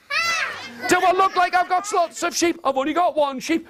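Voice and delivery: mock outrage; indignant scoff. Stan answers the fairy queen with mock outrage, high. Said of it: "He said".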